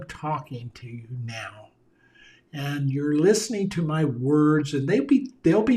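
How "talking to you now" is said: whispered, voiceless and intimate. Shown as a demonstration. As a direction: whisper it straight to the listener. Speaker drops into a whisper mid-talk.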